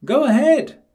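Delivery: high, loud taunting piglet voice; cheeky piglet. A narrator's reading. Percy taunting the wolf from inside, high and loud. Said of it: "said Percy".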